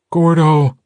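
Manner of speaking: high, loud cry of alarm; desperate yell; terrified shout. A narrator's reading. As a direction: shout the name in terror. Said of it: "Ox shouted".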